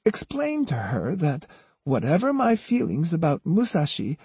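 high, swooping granny voice feigning sincerity; plaintive old woman. A narrator's reading. Osugi sends Jotaro with false peace to Otsu, plaintive.

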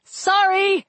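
high called-out apology; yell. A narrator's reading. Walt apologizing across the battle, high mild yell. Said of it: "he yelled".

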